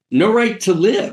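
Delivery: high-pitched mock outrage; indignant repeat. Repeats the quote for emphasis.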